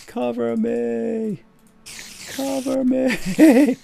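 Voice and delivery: high, slow drawn-out shout; cover me shout. Pretend. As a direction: wail it long and high. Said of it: "Al, cover me".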